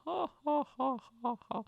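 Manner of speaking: high staged ha ha ha; a taunting sideline laugh; mock mocking laugh. Laughing at tripped marathon runners from the sidelines, mock jeering laugh. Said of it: "I'll be on the sidelines laughing".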